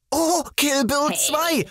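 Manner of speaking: high, slow excited discovery; light up at the idea. Acted. Narrator hitting on the Kill Bill idea, high excited.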